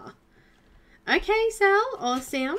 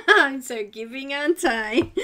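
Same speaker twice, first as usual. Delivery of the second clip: laughing; 8 dB louder than usual. Real, not acted. Joking about giving weight.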